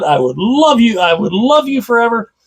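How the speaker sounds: usual speaking voice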